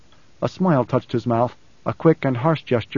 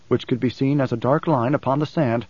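plain reading voice

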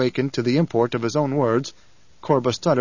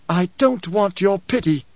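higher-pitched, indignant retort; angry hiss; 6 semitones higher than usual. A narrator's reading. Princess rejecting her husband's pity, hissed in anger. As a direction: hurl it back in fury. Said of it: "she hissed".

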